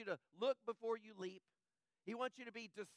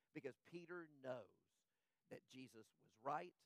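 low, much softer sorrowful telling; somber hush; 7 semitones lower, 8 dB quieter than usual. Acted. Preacher pictures Peter weeping by the wall, hushed and low. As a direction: sink the voice as if at a graveside.

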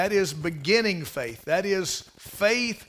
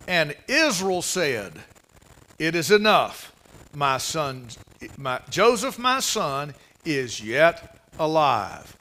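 slow scripture reading; 3 semitones lower, slower than usual. Acted. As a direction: read the verse slowly and solemnly. Preacher reads scripture slowly.

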